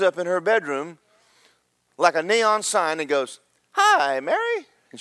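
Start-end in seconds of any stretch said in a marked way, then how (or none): 3.7-4.7 very high, singing-out hello; comic angel greeting. Retold or imitated. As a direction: pipe it up like a cartoonish angel. Angel suddenly greets Mary in bedroom.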